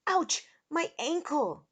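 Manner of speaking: high yelp of pain; pained cry. A narrator's reading. Eric hurt his ankle falling, high pained groan. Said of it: "he groaned".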